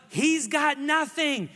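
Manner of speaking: lightly voiced yelling coaches; mock taunting yell. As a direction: jeer at the kid, drawn out.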